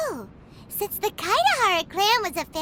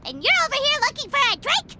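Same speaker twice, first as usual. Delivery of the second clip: indignant; 6 semitones higher, faster than usual. Acted. Paimon scolds the drinker.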